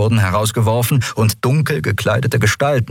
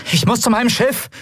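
urgent reply, voiced high. Endriel answers while running.

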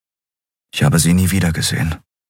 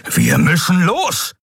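urging; 11 semitones higher, slower than usual. Acted. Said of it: "drängte Keru".

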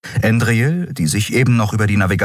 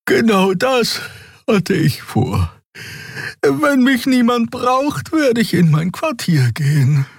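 tired Draxül. A narrator's reading. Xea announces she is off to bed, sleepy Draxül voice. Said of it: "riss ihren Schnabel zu einem Gähnen auf".

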